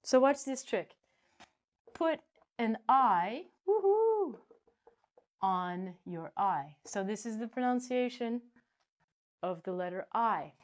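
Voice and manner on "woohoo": high, far woohoo; playful cheer. Acted. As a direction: cheer the trick. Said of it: "cheering in the lesson".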